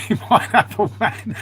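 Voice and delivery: slow, laughed-out words; real laughter breaking through the words; laughing. Real, not acted. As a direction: crack up while saying it. Amused by viewer's name.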